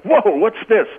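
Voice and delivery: high, hammed-up mock astonishment; mock surprise. Caller mimics fans hearing a neutral broadcaster, mock surprise. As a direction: play the shocked homer fan. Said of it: "it's like".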